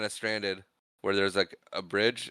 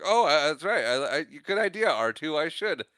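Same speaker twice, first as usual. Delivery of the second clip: high, flustered droid impression; sudden mock realisation, higher-pitched and halting; C-3PO; 7 semitones higher, 4 dB louder than usual. Droid remembering his orders to R two, flustered imitation.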